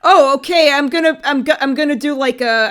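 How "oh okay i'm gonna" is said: usual speaking voice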